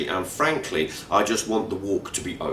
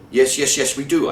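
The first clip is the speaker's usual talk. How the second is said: higher, louder flustered sputter; 6 semitones higher, 8 dB louder than usual. Retold or imitated. Narrator sputtering at Eunice over the route, higher and louder. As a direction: trip over the words in exasperation. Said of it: "I sputter".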